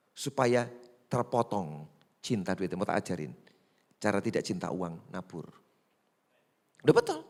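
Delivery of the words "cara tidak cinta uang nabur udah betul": usual speaking voice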